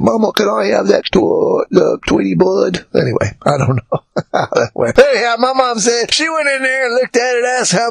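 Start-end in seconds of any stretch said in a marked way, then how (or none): 0.0-2.9 playful mock New York accent. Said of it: "probably with the New York accent".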